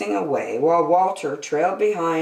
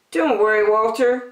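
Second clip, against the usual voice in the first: Billy comforting; 7 semitones higher, 4 dB louder than usual. A narrator's reading.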